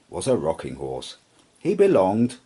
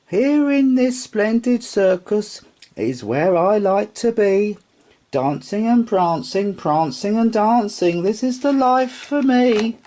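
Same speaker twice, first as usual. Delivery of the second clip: high, happy little rocking horse voice; a contented toy horse, sung out high; Sugarlump's high voice; 12 semitones higher, 4 dB louder than usual. Acted. Rocking horse content in the bedroom, high and happy.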